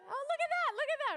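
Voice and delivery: high, excited exclamation; pleasantly shocked at the fans; delighted surprise. Real, not acted. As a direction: squeal at the cheering crowd. Surprised by cheering Mancunians.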